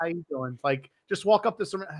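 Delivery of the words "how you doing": smooth pickup line. Retold or imitated. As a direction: play the slick charmer leaning in close. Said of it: "He'll go up, he'll be like".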